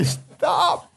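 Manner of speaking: a very high squeaked stop; squeaky toes voice. Pretend. Toes shouting at the foot that kicked, high squeak. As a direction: squeal it like a hurt toe. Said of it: "That's what your toes are telling you".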